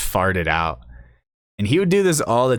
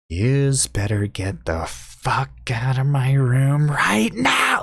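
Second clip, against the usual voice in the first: slow, strained mock fury climbing high; most angry resident; 5 semitones higher, much slower than usual. Old lady throwing out a visitor, slow enraged screech. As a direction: draw out the threat, then screech the ending. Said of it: "just the most angry, pissed off person ever".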